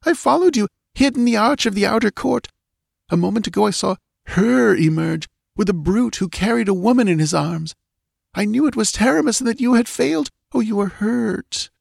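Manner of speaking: voix haute de jeune fille bouleversée, plus rapide vers la fin; elle raconte tout d'un souffle; jeune fille affolée. A narrator's reading. Ivga tells Valerius.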